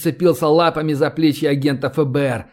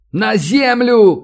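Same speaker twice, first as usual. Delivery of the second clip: loud, high, drawn-out order; shouted warning; 6 semitones higher, 5 dB louder, much slower than usual. A narrator's reading. Man pulling his companion down, urgent shouted warning. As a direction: yell for everyone to drop. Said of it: "крикнул Ростислав и упал".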